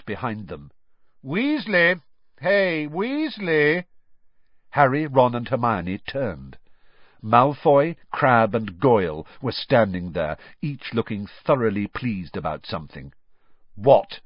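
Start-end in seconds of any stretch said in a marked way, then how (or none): 1.2-3.9 higher, drawn-out taunting call across the hall; shouting to catch a rival's attention; calling out loudly. A narrator's reading. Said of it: "a loud voice rang out". 13.7-14.2 shortly. A narrator's reading. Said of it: "said Ron shortly".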